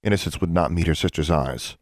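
ordinary delivery, nothing marked

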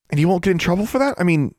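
high, hurried question; eager but concerned; anxious questioning. A narrator's reading. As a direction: check quickly, voice lifted. Worried girl questions the elves.

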